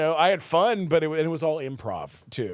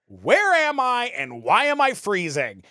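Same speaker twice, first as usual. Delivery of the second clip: lightly acted pilot line, higher and louder; sitcom line read; 7 semitones higher, 5 dB louder than usual. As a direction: deliver the opening line like a sitcom star. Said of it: "first line from the pilot".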